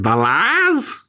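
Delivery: high, lightly put-on Southern accent; a hint of a Southern drawl on the lies; mock Southern accent. Imitating the Robert Pattinson meme, Southern accent. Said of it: "He's doing that Southern accent".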